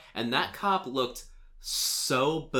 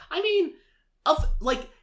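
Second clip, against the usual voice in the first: much higher, louder outburst; indignant; 5 semitones higher, 5 dB louder than usual. Real, not acted. Podcaster protests to cohost about the clerk, high and loud in indignation. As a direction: rant in disbelief at her.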